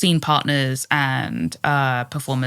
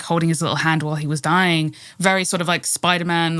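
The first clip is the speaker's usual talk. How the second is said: ordinary talk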